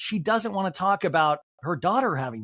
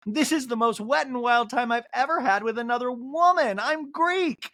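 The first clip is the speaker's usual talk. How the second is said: mock Angie Kay; 8 semitones higher than usual. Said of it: "Angie Kay in a confessional is like".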